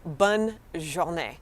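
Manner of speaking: "bonne journée" slow, paused after bonne; word by word. Shown as a demonstration. Teacher showing learners have a good day, slow "bonne journée". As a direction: model "bonne journée" slowly in two parts. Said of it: "you could just say have a good day".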